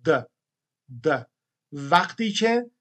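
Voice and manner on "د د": "د" as a lone voiced d with a short vowel; voiced D sound. Shown as a demonstration. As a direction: sound out "د" by itself, pausing between repeats.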